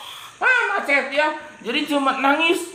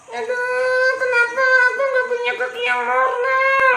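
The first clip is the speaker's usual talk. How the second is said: high, sing-song lament; sad lament; 11 semitones higher than usual. Acted. Limping man laments his leg, sing-song. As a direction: moan for a normal leg. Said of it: "dia ingin punya kaki normal".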